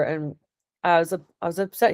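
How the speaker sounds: ordinary talk, nothing marked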